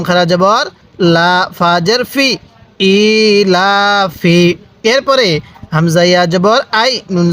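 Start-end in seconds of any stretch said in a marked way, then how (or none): 2.9-4.4 very slow with long vowels; ইলাফি recited very slowly; slow drawn reading. Shown as a demonstration. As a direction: stretch ইলাফি out for the learner. Reading teacher demonstrates the word.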